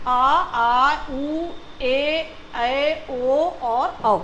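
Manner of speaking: आ, उ, ए, ऐ, ओ drawn out slowly; slow, sustained recital from आ to औ; long vowels. Shown as a demonstration. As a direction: hold each of आ, उ, ए, ऐ, ओ, औ. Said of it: "इनको बोलने में इनसे ज़्यादा टाइम लगता है".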